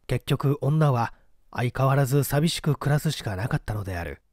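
usual speaking voice

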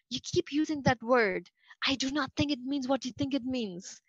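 lightly voiced movie swordsman, no real accent; Inigo Montoya quote. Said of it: "Montoya says to Vizzini".

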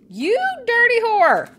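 startled angry shriek; high startled yell. Real, not acted. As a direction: shriek the insult at the zombie. Yelling at a zombie after a scare.

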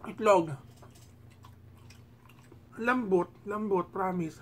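chewing while talking; talking around food; mouth full. Real, not acted. Seen 0: Puts egg piece in mouth, cheeks full chewing while speaking.

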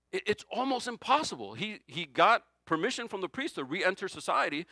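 ordinary talk, nothing marked

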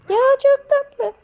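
high, slow, crying-out squeal of delight; cute squeal. Real, not acted. Kid gushing at the cute bear, slow high squeal. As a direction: melt over how cute it is.

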